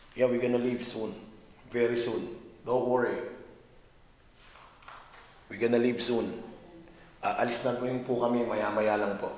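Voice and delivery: loud, clear address to the room; calling out loud. Real, not acted. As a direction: speak up so the spirits hear. Calling out to spirits to leave.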